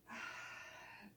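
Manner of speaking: long breathy release sigh; audible sigh. Shown as a demonstration. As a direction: let the breath fall out with a sigh. Teacher models a relaxing exhale.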